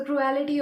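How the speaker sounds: cruelty with an extra syllable, "cru-AL-ity"; mispronounced cruelty. Real, not acted. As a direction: make cruelty rhyme with reality. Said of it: "why am I pronouncing it as cruelty".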